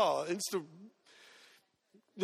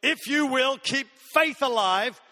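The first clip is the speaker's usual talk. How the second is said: rising, insistent preacher's cadence; stirring sermon crescendo; preaching build; 2 semitones higher, 4 dB louder than usual. Acted. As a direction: build each eventually toward a climax.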